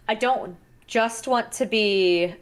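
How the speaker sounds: ordinary talk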